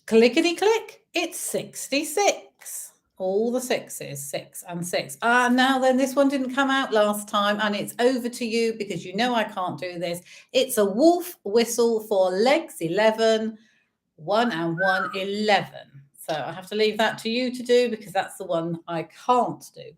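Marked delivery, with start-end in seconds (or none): 0.0-5.2 sing-song bingo number calls; bingo caller. Acted. Online bingo calling numbers. 10.5-16.0 sing-song bingo number calls; bingo caller. Acted. Online bingo calling numbers.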